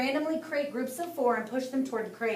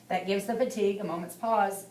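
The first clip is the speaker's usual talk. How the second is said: plain reading voice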